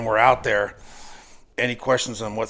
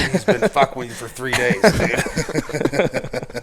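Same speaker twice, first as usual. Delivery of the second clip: laughing; 4 semitones higher, 8 dB louder, slower than usual. Real, not acted. Punchline told through laughter.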